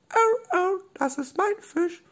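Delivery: tiny, very high squeak; squeaky Robby voice. Acted. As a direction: squeak like a small stuffed animal. Robby guckt Doktor Löffel an. (hohe, piepsige Stimme)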